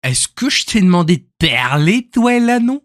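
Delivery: loud mock Québécois, soaring at the end; Canadian French accent. Streamer quoting a clip to viewers, Canadian French accent. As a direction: imitate an indignant Québécois, pitch leaping up. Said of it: "quand il dit".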